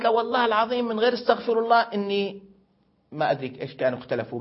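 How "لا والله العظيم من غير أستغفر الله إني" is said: put-on high little girl's voice swearing; childish oath. Father imitating little daughter swearing to her sister, high-pitched. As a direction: pipe it up like a small child insisting.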